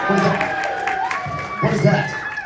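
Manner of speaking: very high, slow, squeaky disbelief; shocked squeal. Acted. Host gasping at the ruined bunny carving, high shocked squeal. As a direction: squeal it like you can't believe your eyes.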